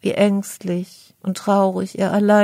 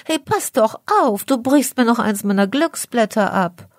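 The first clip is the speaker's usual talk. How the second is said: higher, louder indignant scolding; 4 semitones higher, 4 dB louder than usual. Acted. Clover scolding the beetle who fell on it: loud indignant scolding. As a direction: tell her off for landing on you. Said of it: "Das Kleeblatt schimpft lautstark".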